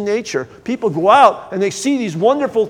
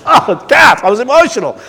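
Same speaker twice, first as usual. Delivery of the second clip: mock emphatic; 4 semitones higher, 7 dB louder than usual. Replaying his words to wife Kathy.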